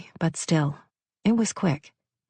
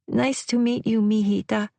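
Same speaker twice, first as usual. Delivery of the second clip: a hint of a Spanish accent, higher; heavy Spanish accent; 5 semitones higher than usual. A narrator's reading. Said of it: "in a heavy Spanish accent".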